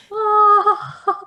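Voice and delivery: high, bright delighted oh; delighted gasp. Real, not acted. Player reacts to bedtime story.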